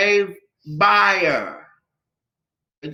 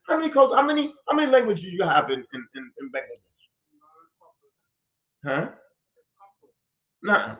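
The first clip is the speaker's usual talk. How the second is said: ordinary delivery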